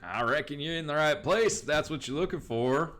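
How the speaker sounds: lightly voiced rancher, lifted in pitch; a hint of an Old West ranch owner; western rancher. Rancher answers stranger seeking Hart Ranch, folksy western voice.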